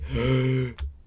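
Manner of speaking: loud surprised gasp; theatrical gasp. Acted. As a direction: gasp in mock amazement. Tower suddenly textured on landing.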